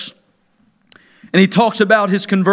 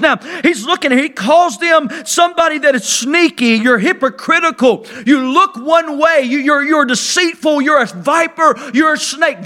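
preaching at full force; 5 semitones higher than usual. Acted. Preacher to his congregation about the Pharisees, preaching at full force. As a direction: thunder it out like a fiery preacher. Preacher voicing John's rebuke.

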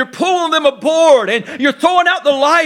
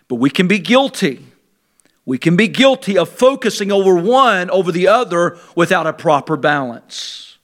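ordinary talk, nothing marked

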